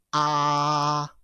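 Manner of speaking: long, level, held "ah"; "ah" drawn out on one steady note; first tone. Shown as a demonstration. Teacher shows first tone sounds like "ah", held level. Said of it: "It's a high-pitched pronunciations".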